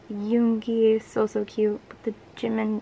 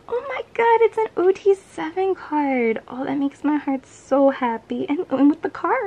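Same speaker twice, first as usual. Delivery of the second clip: much higher and louder, gushing; excited squeal; 6 semitones higher, 5 dB louder than usual. Real, not acted. Fan squealing over a group card. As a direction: burst out in joy. OT7 card makes her happy.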